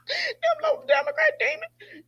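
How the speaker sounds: high, slow, squeezed by laughter; laughing. Real, not acted. Host joking about Democrat operatives, weak with laughter. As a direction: barely get it out after cracking up.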